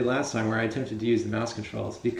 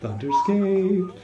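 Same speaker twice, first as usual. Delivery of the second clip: trailer announcer voice; 8 semitones higher than usual. Pretend. Parodies trailer narrator.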